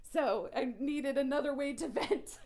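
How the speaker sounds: venting through a real laugh. Real, not acted.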